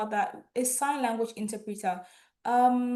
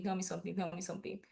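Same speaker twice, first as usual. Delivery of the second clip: softer and softer pleading; fading coax; 3 semitones lower, 10 dB quieter, much faster than usual. Real, not acted. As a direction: trail off coaxing. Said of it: "repeated coaxing".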